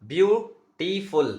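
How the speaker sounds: beautiful said very slowly, pausing between beau, ti, ful; syllable by syllable. Shown as a demonstration. Teacher showing students where the word breaks, slow and syllabic. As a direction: break beautiful into three slow parts. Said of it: "यहाँ पर देखो pronunciation करो".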